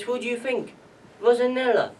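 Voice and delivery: high, pointed retort; exasperated retort. Retold or imitated. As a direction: answer back as if it were obvious. Retorting to father in retold row.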